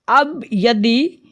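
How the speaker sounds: usual speaking voice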